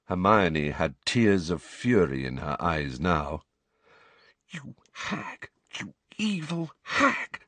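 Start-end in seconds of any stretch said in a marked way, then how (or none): 4.5-7.4 hushed, furious whisper. A narrator's reading. Hermione whispering at Umbridge in fury. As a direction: breathe the insult under your breath. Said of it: "she whispered".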